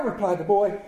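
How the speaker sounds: ordinary delivery, nothing marked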